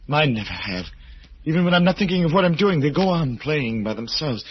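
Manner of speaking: the words tumble out as it dawns on him; feverish excitement. Acted. Roger tells Martin about his fingers, feverishly excited.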